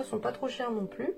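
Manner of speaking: ordinary delivery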